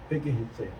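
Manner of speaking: ordinary delivery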